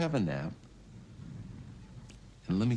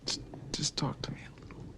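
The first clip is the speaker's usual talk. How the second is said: pleading whisper; 8 semitones higher, 4 dB quieter than usual. Acted. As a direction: breathe it, pleading and soft. Pleading with unresponsive brother.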